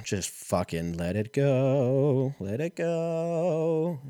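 very slow, stretched, rising high at the end; slow, stretched mock surrender; drawn-out let go. Drawn-out resigned letting go.

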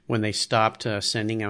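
ordinary talk, nothing marked

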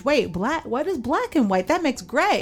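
put-on a six-year-old thinking it through aloud, confused; puzzled child. Recalling her child self sorting skin colours, confused and rising in pitch.

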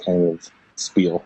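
normal talking voice

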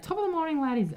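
high, lilting attempt at Irish; amateur mock-Irish greeting; Irish accent. Shown as a demonstration. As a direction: put on a high-pitched stage Irish voice. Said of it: "Can you do an Irish accent?".

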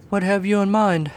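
high, lightly curious serpent; a touch of interest; mildly curious. Acted. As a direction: perk up a little. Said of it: "mildly curious".

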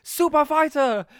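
high, very loud hyped scream; trailer guy screaming. Retold or imitated. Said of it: "this guy screaming about".

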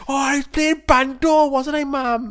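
high, loud mock whinge; hammed-up indignant child; protesting to mum. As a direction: plead your case like a kid in trouble. Plays child protesting to mother.

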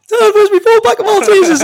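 high and loud, cracking up; laughing. Real, not acted. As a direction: blurt it through a laughing fit. Speaking through a laugh.

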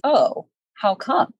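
usual speaking voice

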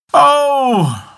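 high, drawn-out wailing yell. A narrator's reading. Greedy man begins to yell, high drawn-out wail. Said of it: "Then he began to yell".

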